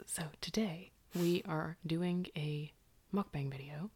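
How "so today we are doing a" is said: ASMR whisper. Acted. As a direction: whisper close to the mic.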